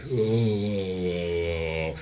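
long, sinking mock groan; acted groan of giving in; drawn-out oh. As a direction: let out a long, falling "ohhh". Acts the subject caving under the question.

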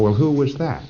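normal talking voice

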